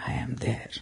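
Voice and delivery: soft, voiceless whisper of the promise; whispered quote. Retold or imitated. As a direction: breathe the words out without voice. Quoting Christ's promise.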